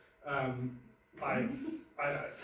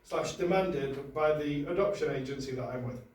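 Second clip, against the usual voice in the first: mock-solemn prepared statement, higher and louder; formal statement; 3 semitones higher, 5 dB louder than usual. Comic reading a prepared statement to the audience, mock-formal. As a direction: announce it like a press release.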